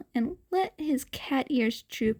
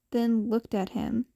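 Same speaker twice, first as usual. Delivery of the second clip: usual speaking voice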